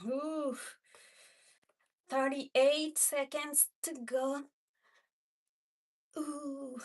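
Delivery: normal talking voice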